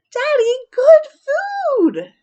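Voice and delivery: put-on high, loud little-girl voice; very high, very loud kid squeal; child shout. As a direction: shout it proudly, hammed-up child. Child calls to father.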